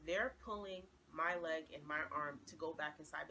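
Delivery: usual speaking voice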